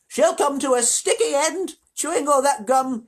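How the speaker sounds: slow, high-pitched dire warning; indignant granny, loud and drawn out; disapproving grandmother. Acted. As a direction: predict doom slowly in a high old-lady voice. Grandmother warns of sticky end.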